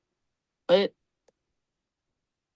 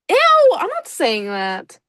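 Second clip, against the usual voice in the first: grossed-out refusal; 8 semitones higher, 5 dB louder, much slower than usual. Real, not acted. Refusing to read the line.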